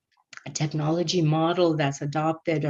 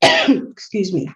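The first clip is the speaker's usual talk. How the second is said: raspy cough, then a strained excuse me; 3 semitones higher, 7 dB louder, slower than usual. Real, not acted. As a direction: cough through a sore throat. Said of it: "my throat and my voice is giving out".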